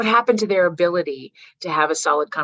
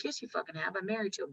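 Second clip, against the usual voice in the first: much softer muttered comeback; unspoken retort; 14 dB quieter, faster than usual. Retold or imitated. Mom silently answering her mother-in-law, soft mutter. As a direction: say it under your breath. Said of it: "didn't say that out loud".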